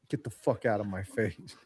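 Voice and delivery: low, quiet, mock-gruff dismissal; a hint of a rude ballplayer growling; gruff brush-off. Ballplayer in a bar telling a fan off, lightly mock-gruff.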